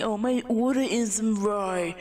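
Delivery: slow, drawn-out mock whine; mocked Karen. Customer complaining about an order, in a mock whine. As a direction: drag out the complaint mockingly.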